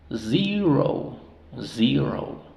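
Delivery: "zero" with long "zee" and gliding "rou"; long-vowel zero. Shown as a demonstration. Teacher modelling both open syllables, zero as "zee-rou". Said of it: "la O dice su nombre".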